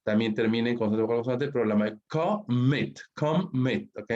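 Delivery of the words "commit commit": "commit" partido en co y mit, fuerza final; sílabas separadas. Shown as a demonstration. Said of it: "Mayor fuerza de voz al final". ("commit" silabeado, con acento al final)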